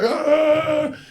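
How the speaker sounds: sudden high yelled "Ah!"; crowd yell. Retold or imitated. Comic imitating the derby crowd's yell.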